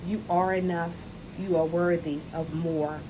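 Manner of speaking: ordinary delivery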